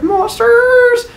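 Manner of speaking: very high, drawn-out singsong; excited villagers. Acted. Villagers heading over to peek at monsters, high excited singsong. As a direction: squeal it with eager curiosity.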